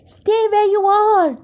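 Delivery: high, urgent-sounding narrated shout; mild shout. A narrator's reading. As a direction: call out a warning, pitched well up. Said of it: "Jack shouted".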